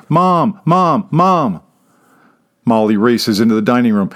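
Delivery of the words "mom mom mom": put-on high child's voice calling Mom; little girl mimicking. A narrator's reading. Twin girl mimicking her brother, calling mom. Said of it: "Kate mimics her twin brother".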